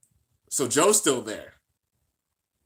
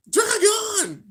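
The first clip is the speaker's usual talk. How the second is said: a much higher, louder yelled name; excited call; 5 dB louder than usual. Real, not acted. Cheering as the bey speeds up, excited call. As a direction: shout the name out in hype.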